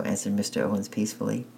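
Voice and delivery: usual speaking voice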